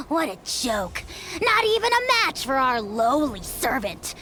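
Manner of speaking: ordinary talk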